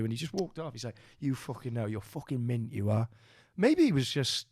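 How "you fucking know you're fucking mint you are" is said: softer imitated Geordie parting line; understated mock-Geordie; Northern English accent. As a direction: retell it as a stoic Geordie bloke. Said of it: "he had, like, a Geordie accent or a Northern accent".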